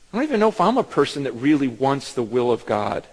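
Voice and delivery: normal talking voice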